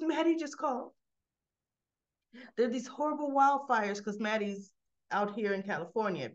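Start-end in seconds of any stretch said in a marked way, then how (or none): 0.0-4.0 high-pitched, alarmed retelling; frantic. Retold or imitated. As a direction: act out a frantic mother's bad news. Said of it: "Lily comes back in frantic".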